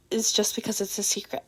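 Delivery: usual speaking voice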